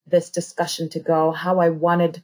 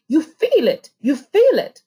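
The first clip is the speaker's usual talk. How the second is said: emphatic urging; 7 semitones higher, 4 dB louder than usual. Real, not acted. Urging listeners they feel it.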